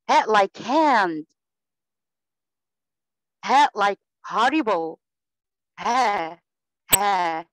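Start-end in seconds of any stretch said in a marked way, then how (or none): 0.1-1.2 ها drawn out very slowly with a strong breathy h, then hand; breathy h demo. Shown as a demonstration. Teacher shows a foreign student the h sound, slow and loud. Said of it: "بجيب له كلمة فيها حرف الهاء". 3.4-5.0 ها drawn out very slowly with a strong breathy h, then hand; breathy h demo. Shown as a demonstration. Teacher shows a foreign student the h sound, slow and loud. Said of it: "بجيب له كلمة فيها حرف الهاء".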